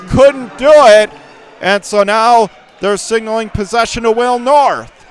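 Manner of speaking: excited call. Real, not acted. Live call of a loose ball.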